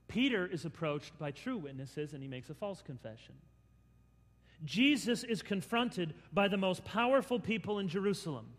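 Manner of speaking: usual speaking voice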